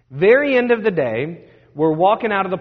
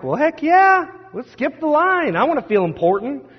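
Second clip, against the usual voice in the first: eager excitement; 10 semitones higher than usual. Retold or imitated. Excited teen voice in retold story.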